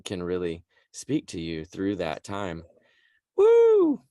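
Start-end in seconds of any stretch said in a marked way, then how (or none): 3.4-4.0 high, loud whoop of delight; excited whoop. Real, not acted. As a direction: let out a joyful whoop. Joyful cheer mid-conversation.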